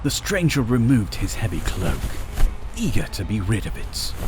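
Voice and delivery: usual speaking voice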